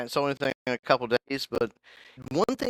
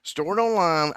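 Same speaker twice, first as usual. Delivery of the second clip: exasperated; 8 semitones higher, 4 dB louder than usual. Retold or imitated. Mock talking to the app.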